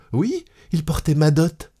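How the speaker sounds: high woman's voice; distressed wife. A narrator's reading. Wife telling her cousin about her dowry, distressed. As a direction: answer him in a high, helpless voice.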